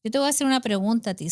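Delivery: ordinary delivery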